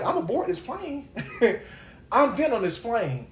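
high, laughing declaration; playful resolve. Retold or imitated. Retelling how she decided to board the plane, playfully resolved. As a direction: pitch jumps up, tickled and determined.

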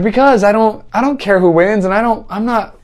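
high-pitched imitation of her defending herself; retold defensive objection; flustered protest. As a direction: mimic her sputtering a defence, voice way up. Retold flustered self-defence by woman.

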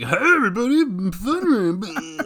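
high, slow, hammed-up announcement; goofy show-off. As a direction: stretch it out like a show-off. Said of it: "I'm like".

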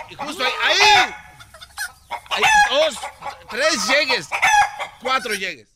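excited calling out. Acted. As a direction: call out each hit like a replay. Counting the blows on video.

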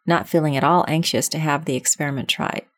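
plain reading voice

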